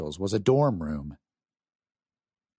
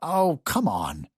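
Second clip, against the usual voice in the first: prodding; 6 semitones higher, slower than usual. Acted. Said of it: "Walter prodded".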